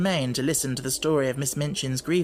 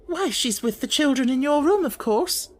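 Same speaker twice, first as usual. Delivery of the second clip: much higher, nervous sister voice; timid sister; 11 semitones higher, 3 dB louder than usual. Acted. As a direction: answer meekly and anxiously.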